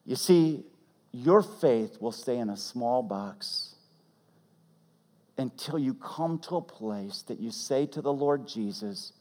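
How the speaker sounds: normal talking voice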